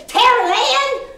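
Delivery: high, loud imitation of mom in a Texas accent; a hint of a Texas mom calling out; mom's Texas accent. Mom runs out of the house to her daughter, light Texas accent. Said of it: "you have got a Texas accent".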